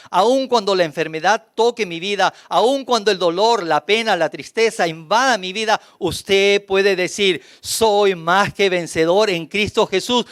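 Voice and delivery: rising, fervent declaration of victory; preaching. Acted. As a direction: build the proclamation line by line. Preaching at full force. (predicación ferviente y creciente)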